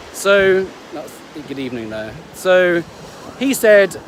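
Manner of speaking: usual speaking voice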